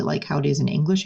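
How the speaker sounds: normal talking voice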